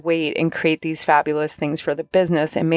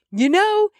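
much higher, whiny pleading voice; exasperated plea to spirit guides; 10 semitones higher than usual. Retold or imitated. Talking to her spirit guides, high exasperated whine. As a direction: beg the spirit guides, frazzled. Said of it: "I said".

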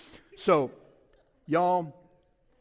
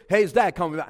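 louder, pushy voicemail greeting; insistent dad; 10 dB louder, faster than usual. Acted. Dad phoning the silent kid once more, insistent. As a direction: hit it hard like the umpteenth call.

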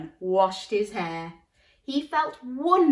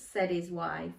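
usual speaking voice

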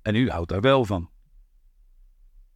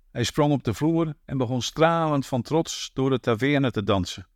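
ordinary delivery

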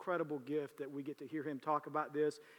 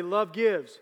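Love gives called out loud to the church; 3 semitones higher, 11 dB louder than usual. Shown as a demonstration. Pastor to his congregation, loud call-and-response shout. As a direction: lead the room in a loud shout. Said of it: "look at your neighbor and say".

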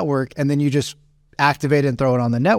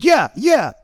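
high, loud retold retort; super loud; 9 semitones higher than usual. Angry customer shouts back at the friend, high and loud. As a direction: imitate an irate man shouting back. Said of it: "they're both, like, super loud".

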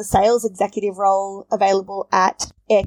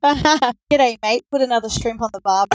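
broad Australian accent, laughing; hammed-up Australian catchphrase; 3 semitones higher than usual. Shown as a demonstration. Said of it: "I just wanna hear you say".

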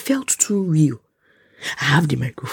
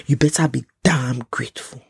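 normal talking voice

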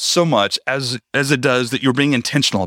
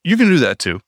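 ordinary delivery

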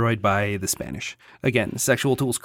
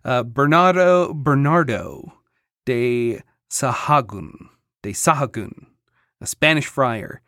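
normal talking voice

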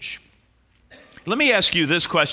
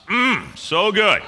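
savoring; 5 semitones higher, slower than usual. Pretend. Savoring remembered gravy.

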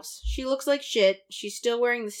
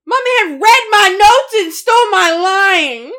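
mock outraged shout; 10 semitones higher, 18 dB louder than usual. Real, not acted.

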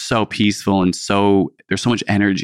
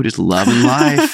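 dreamy gush; 3 dB louder, slower than usual. Retold or imitated. Hamming up a cheerful reply to neighbor.

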